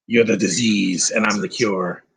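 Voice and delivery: low, slow menacing quote; lightly voiced movie villain; movie villain line. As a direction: growl it like the film's villain.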